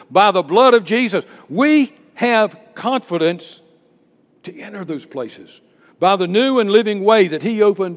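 loud scripture proclamation with punched key words; full-force preaching. Real, not acted. Preacher reading of confidence to enter, full-force preaching. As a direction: proclaim the verse boldly to the church.

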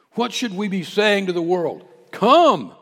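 loud high cry of "Come"; calling out. Acted. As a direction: call out to the whole world. Preacher calling the world.

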